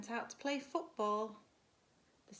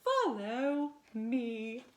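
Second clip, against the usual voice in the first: slow, loud beckoning; mysterious godmother; 6 dB louder, much slower than usual. Acted. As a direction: draw her after you slowly.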